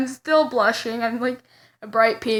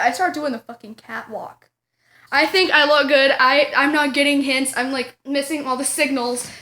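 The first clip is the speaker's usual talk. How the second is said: ordinary delivery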